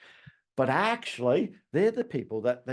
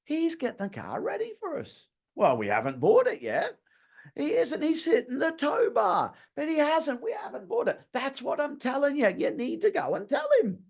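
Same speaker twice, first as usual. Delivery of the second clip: higher, quarrelling couple; couple bickering; 11 semitones higher than usual. Retold or imitated. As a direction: act both sides of a squabbling couple. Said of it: "I overheard this lovely couple".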